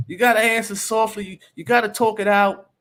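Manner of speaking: mock holy softie. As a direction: mimic the overly gentle church advice. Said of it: "they acting all holy".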